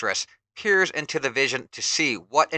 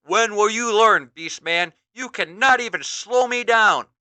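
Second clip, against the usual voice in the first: much higher, louder confident taunt; taunting hero; 8 semitones higher, 7 dB louder than usual. Acted. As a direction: taunt the beaten foe. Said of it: "He-Man says".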